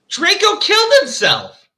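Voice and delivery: high, slow, stunned exclamation; shocked disbelief. Real, not acted. Reader reacting to a sudden death in the story, shocked. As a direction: say it as if you can't believe it.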